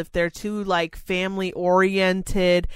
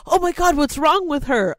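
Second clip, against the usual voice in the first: higher, louder alarmed gasp; alarmed partner; 9 semitones higher, 5 dB louder than usual. Retold or imitated. Imitating the partner seeing her cry, alarmed and higher. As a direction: sound startled by the tears.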